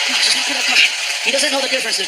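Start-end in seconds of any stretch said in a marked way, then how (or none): 0.1-0.8 whispered plea to play along; hushed, insistent aside; urgent aside. Acted. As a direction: whisper it urgently under the act. Pleading secretly with officer.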